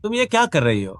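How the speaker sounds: high, startled challenge; shocked demand. Acted. Man catches woman snooping, shocked high demand. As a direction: catch her red-handed and demand an answer.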